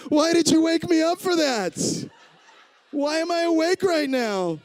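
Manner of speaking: very high-pitched comic wail; mock panicked protest. Woken mid-surgery, protests to surgeon.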